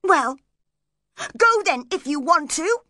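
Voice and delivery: high, loud, huffy dismissal; petulant cry. A narrator's reading. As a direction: cry it out in a temper. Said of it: "she cried".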